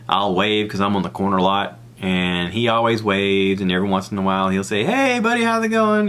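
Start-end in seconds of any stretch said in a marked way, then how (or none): 4.8-6.1 high, cheery imitated holler; friendly greeting. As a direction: call out like a friendly neighbor.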